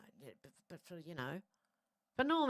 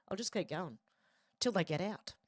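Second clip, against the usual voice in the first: normal talking voice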